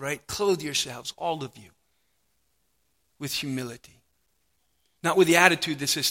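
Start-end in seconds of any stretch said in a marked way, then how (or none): 0.3-4.0 soft repeat. Shown as a demonstration. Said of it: "same verse repeated softly".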